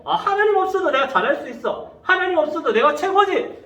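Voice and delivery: high-pitched cocky show-off; acted self-important boast; mock boasting. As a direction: brag as if you need nobody. Acts out a proud boaster.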